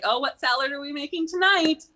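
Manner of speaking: high, eager imitation of curious staff; teasing store employees. Grocery employees greeting her in rollers, high mock-eager questions. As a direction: imitate them with a high, rising lilt.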